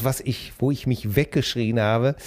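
usual speaking voice

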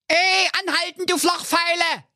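very high squeaky critter voices yelling from below; high cartoon animal voices, desperate; hare and hedgehog calling. A narrator's reading. Hare and hedgehog yell up at the speeding Honk, high squeaky voices. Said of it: "von unten verzweifelt gegen die Ölwanne klopften und dabei riefen".

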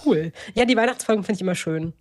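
ordinary talk, nothing marked

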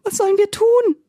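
a scared little pig crying out for help; frightened piglet. A narrator's reading. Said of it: "fragte das erste Schweinchen ängstlich". (ängstliches Ferkel, hoch und laut)

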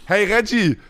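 mock German accent; hammed-up German accent; German-accented English, half-joking. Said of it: "so richtig so mit deutschem Akzent".

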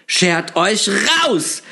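high, slow screech to get out; shrieking. A narrator's reading. Shopkeeper screeches at the intruders to leave. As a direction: drag out a frightened order to leave. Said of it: "schrie sie auf einmal".

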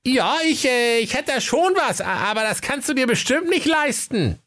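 very high, teasing shopkeeper voice; cheeky Dennis Höke. Acted. Höke goads Kaiser about money, high and cheeky. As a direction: bait him cheekily, with small hesitations. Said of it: "überlegte nicht lange und sprach keck".

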